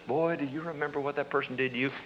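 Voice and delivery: soft, hushed insinuating voice; little quiet voice. Shown as a demonstration. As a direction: murmur it like a voice inside your head. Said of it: "It is a little voice in there says".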